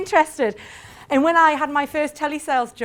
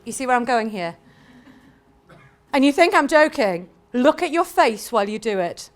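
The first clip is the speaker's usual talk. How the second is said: ordinary talk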